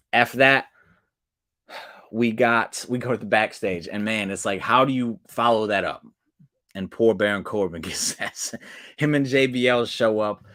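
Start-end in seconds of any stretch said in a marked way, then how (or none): none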